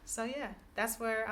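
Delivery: normal talking voice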